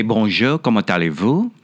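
French spoken as a modest accent demo. Shown as a demonstration. Voice actor showing the hosts a favorite accent, in French. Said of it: "I like doing the French accent".

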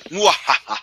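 mock evil laugh; hammed-up villain cackle; sneering panto villain. Gloating over tired enemy.

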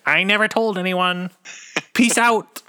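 high, secretive collector sign-off; lightly voiced, teasing secret-keeper; Steve Sansweet voice. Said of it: "Does Steve Sansweet have it?".

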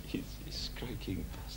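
hushed, conspiratorial whisper; whispered aside. Retold or imitated. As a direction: breathe it under your breath like a secret insult. Muttered aside about the baby.

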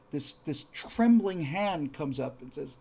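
ordinary talk, nothing marked

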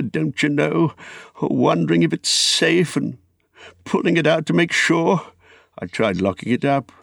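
high-pitched, anxious confession; fretful Bilbo. Acted. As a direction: unburden yourself as a worried hobbit.

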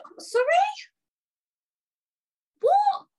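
high, sharp retold disbelief; incredulous. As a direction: re-enact a loud, disbelieving "what?". Retold incredulous reaction to the manager.

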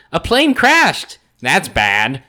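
slow, overstated dub line; bad cartoon dialogue. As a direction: read it like a clumsy cartoon dub. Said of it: "the dialogue is just like".

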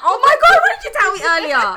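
high, loud mock outburst; exclaimed realisation. Friend learns too late about disliked guest, loud exclamation. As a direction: blurt it out, pitch leaping at the start.

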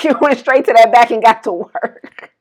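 normal talking voice

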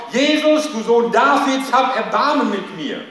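loud, high, pleading shout to Jesus; desperate cry. A narrator's reading. Blind beggar calling to passing Jesus, loud pleading shout. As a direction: cry out over the crowd for mercy. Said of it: "schrie er laut".

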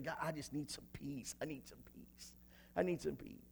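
very soft, nearly whispered prayer; hushed plea. Acted. Voicing someone praying to God for peace, hushed plea. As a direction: let the plea fade almost to nothing.

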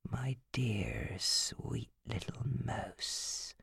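very soft, slow whisper; creepy whisper. A narrator's reading. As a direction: linger over each word. Phil whispering.

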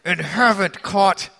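strained politeness, drawn out; slow, over-polite protest; forced toothy grin. Acted. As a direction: say it through a forced, toothy smile. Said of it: "through a forced, toothy grin".

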